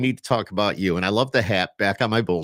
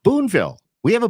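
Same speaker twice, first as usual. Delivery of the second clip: excited echo; 13 semitones higher, 7 dB louder, faster than usual. Real, not acted. Repeating hometown excitedly.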